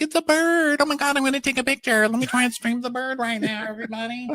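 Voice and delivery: high-pitched excited squeal of discovery; mock excitement. Podcaster imagines spotting a bird at home, mock excitement. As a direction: gush in a hammed-up high voice.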